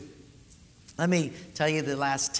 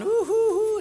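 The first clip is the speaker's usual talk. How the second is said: mock crowd cooing; 6 dB louder than usual. Crowd admiring preacher.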